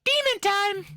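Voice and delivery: very high, loud shout; high squealed hype shout; demon time shout. Acted. Shouting bit.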